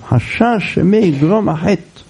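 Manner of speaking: ordinary talk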